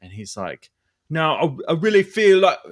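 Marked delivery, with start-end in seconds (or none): 1.1-2.6 high, loud put-on voice of a friend; Dan voice. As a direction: pitch it up and push it loud. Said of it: "Not a good impression".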